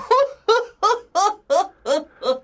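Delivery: long high run of ha-has; high villainous laughter; gloating fox laugh. A narrator's reading. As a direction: cackle at length, high and pulsing. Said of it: "and laughed again".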